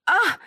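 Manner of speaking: high yelp when slapped; a sudden startled ow; short pained shout; sharp cry of pain. Acted. Daughter slapped, cries out.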